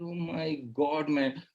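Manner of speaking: low, slow, soft disbelief; quietly appalled aside; mock dismay. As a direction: sink into weary disbelief. Exasperated at absurd excuse.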